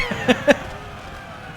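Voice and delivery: high, breathy burst of laughter; unvoiced high laugh; gleeful cackle. Real, not acted. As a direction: cackle with delight at the win.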